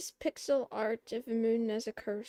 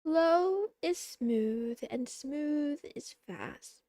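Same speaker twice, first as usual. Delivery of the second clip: slow, deliberate motto; slow motto delivery; slow saying; 2 semitones higher, 3 dB louder, slower than usual. Shown as a demonstration. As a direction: drawl the saying out slowly. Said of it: "you know what I like to say?".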